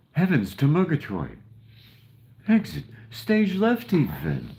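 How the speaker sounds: theatrical cartoon cat exiting grandly; Snagglepuss. Imitating a cartoon lion's catchphrase for the viewers, high and hammy. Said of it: "Snagglepuss used to say".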